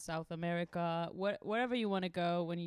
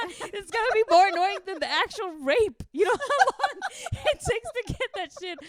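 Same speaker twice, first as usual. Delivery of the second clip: much higher and louder, laughing indignation; outraged laughing rant; 11 semitones higher, 10 dB louder than usual. Retold or imitated. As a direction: rant in high-pitched disbelief.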